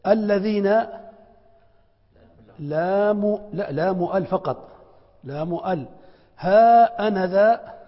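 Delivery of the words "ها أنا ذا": ها أنا ذا very slow, loud and higher; drawn-out example. Shown as a demonstration. Teacher reading the example to students, slow and loud. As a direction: stretch each part of ها أنا ذا. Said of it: "ألفان".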